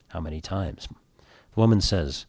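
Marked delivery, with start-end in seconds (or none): 0.1-0.9 hushed low confessional question; low quiet priest. Acted. Priest quietly asks the woman in confession, hushed and low. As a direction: ask it low and soft, like a priest.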